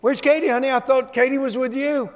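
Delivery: high, big mock-preacher call across the garage; booming pretend-preacher question; loud preacher's voice. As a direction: ham it up like a pulpit preacher calling his wife. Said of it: "in a loud preacher's voice".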